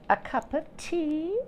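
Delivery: a lightly voiced high queen ant, slow. A narrator's reading. Storyteller voicing the queen ant offering tea, lightly high. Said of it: "asked Queen Ant".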